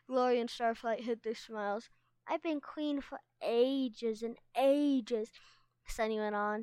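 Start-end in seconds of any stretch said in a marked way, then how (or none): 2.3-5.3 slow, drawn-out mock-regal boast; hammed-up royal self-importance; pretend boastful queen. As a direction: stretch it like a child playing a grand queen. Sunny plays a boastful queen.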